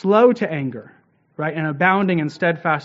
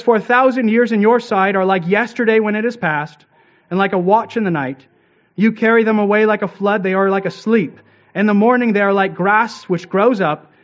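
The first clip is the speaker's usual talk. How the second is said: raised, solemn reading of the psalm, a bit louder; scripture reading; 3 semitones higher, 4 dB louder than usual. Acted. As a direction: proclaim the verses to the congregation. Reads Psalm aloud in sermon.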